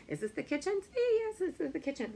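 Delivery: high, eager question and answer; playful quizzing. Shown as a demonstration. Teacher models the yes answer for kids, playfully excited.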